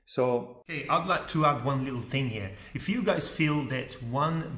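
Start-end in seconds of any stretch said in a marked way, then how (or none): none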